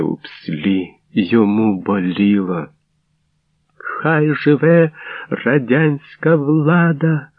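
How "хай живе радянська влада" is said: high, slow, drawn-out slogan shout; all-out defiant cry. A narrator's reading. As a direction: cry it out slowly with all your strength. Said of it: "вигукнула щосили Ольга". (протяжний вигук щосили)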